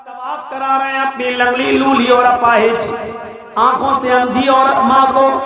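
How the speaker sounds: normal talking voice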